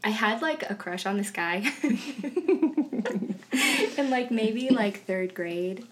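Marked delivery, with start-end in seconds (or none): 1.8-3.9 rising bashful laugh; high embarrassed laugh; embarrassed giggle. Real, not acted. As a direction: giggle shyly at the memory. Embarrassed about childhood crush.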